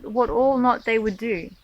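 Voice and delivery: ordinary talk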